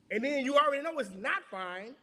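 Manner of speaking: ordinary talk, nothing marked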